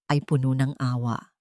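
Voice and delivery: plain reading voice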